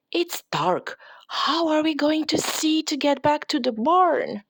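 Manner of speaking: put-on high, excited girl voice pointing things out; little girl voice, curious and playful; bright little girl Lucy. A narrator's reading. Lucy voiced in read story.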